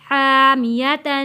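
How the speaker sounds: slow chanted हामियतन; Quranic recitation. Shown as a demonstration. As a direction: recite हामियतन slowly with tanween. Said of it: "ता दो ज़बर तन".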